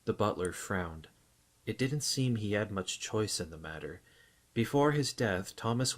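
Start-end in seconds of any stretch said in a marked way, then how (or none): none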